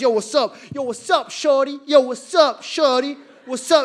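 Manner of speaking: put-on hollering like a teen trying to act cool; wannabe gangsta holler. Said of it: "just hollering at Indian girls".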